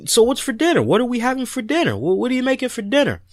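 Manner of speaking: high, quick, eager questions about dinner; eager asking. Retold or imitated. Hungry guests asking the host, high and quick. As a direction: keep pressing what's for dinner, fast and high. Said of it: "And they were like".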